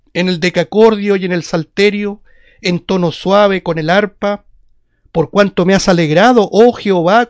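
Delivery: loud, declamatory psalm reading; scripture reading. Acted. Preacher reads a psalm to the brethren, loud solemn proclamation. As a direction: proclaim the verses in measured phrases, opening strongly.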